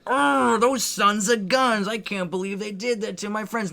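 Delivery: high, loud, slow indignant cry; outraged friend. Retold or imitated. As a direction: wail at the injustice. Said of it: "Lloyd's death is a lot more like".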